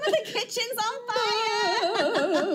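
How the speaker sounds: high crying shout for mum; panicked shout. Pretend. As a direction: wail it like a panicked kid. Imagined call to mum about fire.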